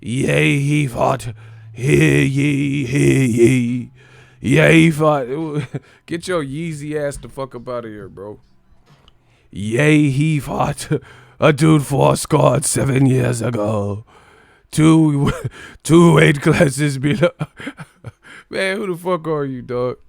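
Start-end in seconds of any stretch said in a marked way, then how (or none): 0.0-5.3 loud, pompous fake Old English proclamation; hammed-up herald voice; mock medieval voice. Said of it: "talking in medieval talk". 9.5-17.3 loud, slow fake herald, cracking up; hammed-up medieval crier breaking into laughter; mock Old English voice. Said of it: "talking in fucking old English".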